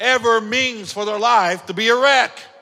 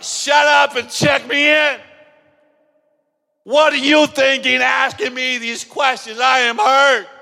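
loud, higher, fed-up shouting; an angry patient yelling at the nurse who questions him; 4 semitones higher, 5 dB louder than usual. Retold or imitated. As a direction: snap back at the nurse in pain. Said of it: "you'd be like".

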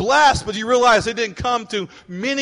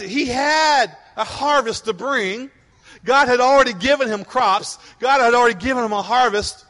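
loud, driving sermon voice; fiery preaching; 3 semitones higher, 4 dB louder than usual. Real, not acted. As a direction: preach it to the room. Preaching at full force.

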